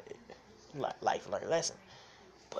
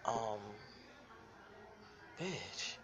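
ordinary delivery

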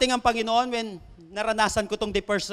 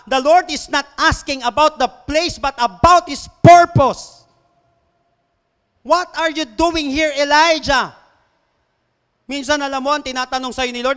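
full-force preaching; 5 semitones higher, 8 dB louder than usual. Acted. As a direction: hammer the point home to the congregation, stretching the key phrases. Preaches the point to congregation.

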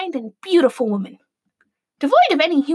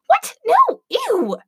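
snapping; 12 semitones higher, 4 dB louder, much slower than usual. Acted. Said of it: "snapped Adriana".

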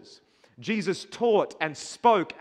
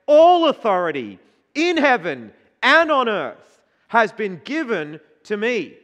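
proclaiming; 5 semitones higher, 9 dB louder than usual. Acted. Proclaiming Jesus' words.

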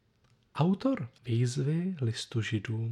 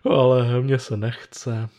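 louder, slow, reluctant lazy self; 8 dB louder than usual. Acted. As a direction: drag it out like a lazy inner voice refusing to get up. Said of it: "Na to se ozve moje líné já".

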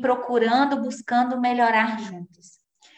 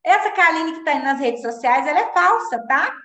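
raised, quickened imagined accusation; anxious high outburst; 6 semitones higher, 4 dB louder than usual. Retold or imitated. Mother imagining son calling her fake, raised panicked voice. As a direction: blurt it out as if the son exposes her. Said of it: "eu falei".

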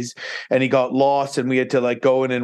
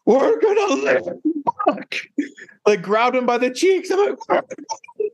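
very high, choked-up cry of relief; tearful relief. Retold or imitated. Hugging his dog Buck in the tent, tearful high cry. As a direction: sob it out to the dog, overjoyed to survive. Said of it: "I said".

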